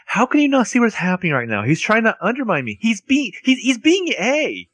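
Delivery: high, urgent, rising panic; frantic pleading. Retold or imitated. Spencer pleads with friends who don't believe her, high frantic plea. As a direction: beg them to see it, voice climbing.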